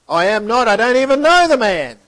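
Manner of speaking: high, loud, defensive denial. Acted. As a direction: shout the denial in panic. Peter denies knowing Jesus.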